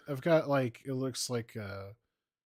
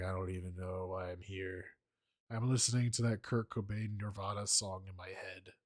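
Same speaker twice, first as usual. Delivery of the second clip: low, soft mock-brooding monotone; brooding mumble; 6 semitones lower, 7 dB quieter than usual. Fan mocking Batman staring into space, low brooding mumble. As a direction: murmur it like a moody loner lost in thought.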